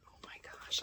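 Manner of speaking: hushed gasp of wonder; awed whisper. Real, not acted. Whispering in awe at the unboxed gift. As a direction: breathe it out in awe.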